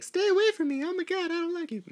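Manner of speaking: very high mock child squeal; little boy voice. Little boy tells the clown to stay away, squeaky mock-child voice. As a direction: squeak it like a panicked little kid. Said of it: "the little boy's like".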